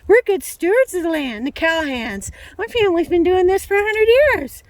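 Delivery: mock the smug rancher family; hammed-up rancher pride. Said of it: "Somebody will say".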